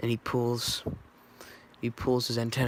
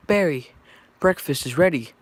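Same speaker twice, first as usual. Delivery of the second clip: raised-voice call, louder and higher; yelling up; 4 semitones higher, 7 dB louder than usual. A narrator's reading. Mother calls Barry up to breakfast, yelling. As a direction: call out to someone upstairs. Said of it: "yells up at Barry".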